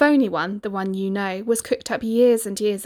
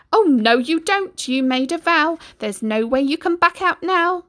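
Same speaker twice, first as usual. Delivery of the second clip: pin him to his promise; ugly sister's voice; 8 semitones higher, 6 dB louder than usual. Acted. Ugly sister refusing to let the prince go, high and bossy.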